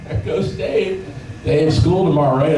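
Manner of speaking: high-pitched mock cop chatter; cops' voices. Cops spot the boy biking on a school night, high imitated voices. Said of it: "The cops would be like".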